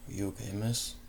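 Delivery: breathless, soft concern; out of breath. Acted. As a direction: ask while still panting. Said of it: "I panted harshly".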